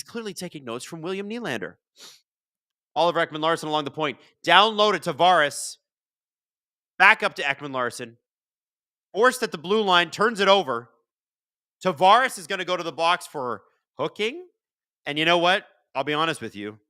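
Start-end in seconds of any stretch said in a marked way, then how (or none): 2.9-14.5 play-by-play. Acted. Live play-by-play.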